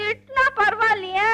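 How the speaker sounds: high-pitched tearful complaint; put-on sobbing voice; crying. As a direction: whimper your complaint to daddy. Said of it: "ஏன் அழுவுறீங்க?".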